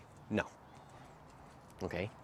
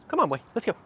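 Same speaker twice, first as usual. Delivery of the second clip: upbeat dog call; 11 semitones higher, 4 dB louder, faster than usual. Real, not acted. Trainer calling dog along.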